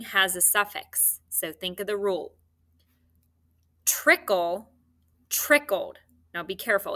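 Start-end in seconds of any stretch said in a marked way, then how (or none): none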